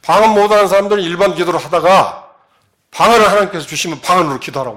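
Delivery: a revival pastor directing the whole congregation to pray; preacher's command. Retold or imitated. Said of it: "이렇게 나오는 거예요".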